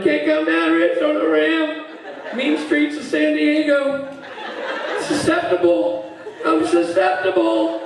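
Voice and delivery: high, drawn-out near-singing wail; drunk Rob. Retold or imitated. Lost friend refusing to calm down, high sing-song lament. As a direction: intone it like a melodramatic drunk. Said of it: "phone call from Rob".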